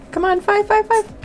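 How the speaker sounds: high pleading chant at the dice; will the dice to land five; excited high-pitched begging; urging the dice. Real, not acted. Urging dice during timed roll.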